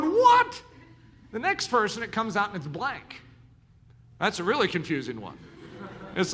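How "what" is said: very high, loud shocked cry; shocked what. Retold or imitated. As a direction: yelp in disbelief. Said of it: "looking at yours going".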